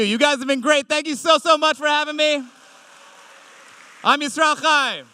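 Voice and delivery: high, loud sign-off to the crowd; big thank-you. Real, not acted. Comic thanking the audience at the close, loud and high. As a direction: send them off with energy.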